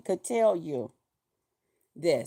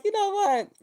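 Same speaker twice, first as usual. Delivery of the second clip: excited; 12 semitones higher than usual. Retold or imitated. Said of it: "I was like".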